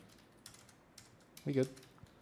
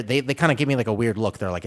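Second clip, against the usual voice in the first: usual speaking voice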